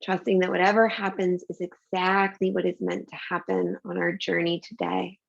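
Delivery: slow, calm breathing guidance; guided meditation. Acted. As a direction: soothe them through each breath.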